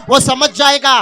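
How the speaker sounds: ordinary talk, nothing marked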